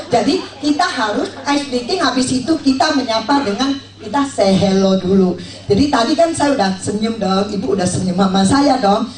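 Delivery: ordinary talk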